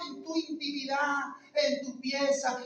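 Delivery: usual speaking voice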